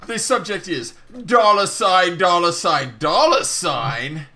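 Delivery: loud, theatrical announcement with drawn-out words; dramatic message reading. Acted. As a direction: declaim the message grandly.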